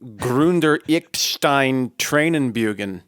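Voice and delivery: slow, loud fake German gibberish; mock German. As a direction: bluff a German sentence. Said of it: "I would have gone with something like".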